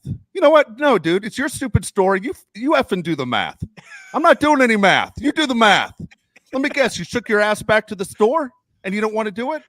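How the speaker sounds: loud, high, fed-up rant; indignant outburst. Acted. Refusing to do math for someone's story, indignant outburst. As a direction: blow up at the storyteller.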